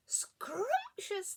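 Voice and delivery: high, relishing, drawn-out word; delighted space rabbits. A narrator's reading. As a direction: savour it like a greedy little creature. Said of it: "they were saying".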